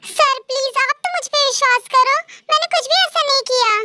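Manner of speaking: high, pleading, speeding up at the end; desperate plea. Acted. V begs the boss to trust her, desperate and high. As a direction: beg him to believe you.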